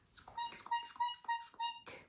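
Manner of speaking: tiny high mouse squeaks; very high, soft mouse squeak; squeaky mouse. Acted. Mouse noises in picture book.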